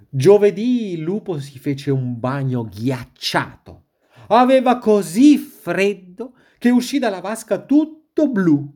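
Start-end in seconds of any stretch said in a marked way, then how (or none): none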